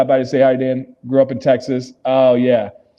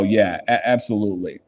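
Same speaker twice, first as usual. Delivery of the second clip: ordinary talk, nothing marked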